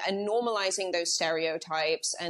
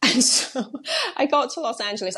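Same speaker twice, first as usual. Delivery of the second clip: laughing through the words, higher; amused, higher-pitched, losing composure; 6 semitones higher, 4 dB louder than usual. Real, not acted. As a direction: start the line through a fit of giggles. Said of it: "I don't know why I'm laughing".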